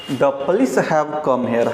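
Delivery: slow, careful "the police HAVE come here"; stress on have. Shown as a demonstration. Teacher stresses "have" for plural police. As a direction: lean on "have" slowly. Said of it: "have not has".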